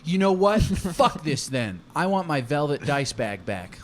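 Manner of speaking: loud, high outburst that settles; acted angry quitting. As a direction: storm out of the game in a huff. Acted quarrel, player quits.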